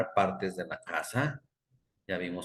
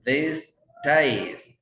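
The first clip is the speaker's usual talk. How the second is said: These ties said higher and loud; stress on these ties; 6 semitones higher, 8 dB louder than usual. Shown as a demonstration. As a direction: punch These ties for the plural. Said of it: "ya trae más". (These ties marcado y más agudo)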